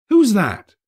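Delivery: high, loud who-goes-there; startled young host; Fred's surprised call. A narrator's reading. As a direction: nephew calling out to the door. Fred voiced in the story.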